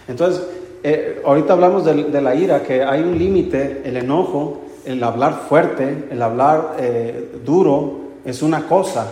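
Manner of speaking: ordinary delivery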